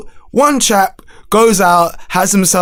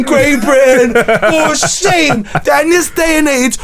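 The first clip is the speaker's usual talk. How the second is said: hammed-up moral outrage, higher; mock outrage; 6 semitones higher than usual. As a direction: preach mock shame at Britain. Mock outrage rant at country.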